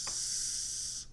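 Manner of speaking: a long voiceless "s-", no buzz in the throat; isolated hissed "s-"; unvoiced s sound. Shown as a demonstration. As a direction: hiss "s-" with no voice. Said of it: "since the last sound is".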